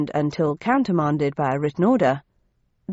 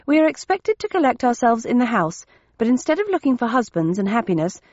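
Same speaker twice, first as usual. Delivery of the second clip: high, respectful storybook sister; respectful Bennett sister; 5 semitones higher than usual. Acted. Bennett sister reporting her instructions, high-voiced and respectful. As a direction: explain obediently in a higher-pitched voice.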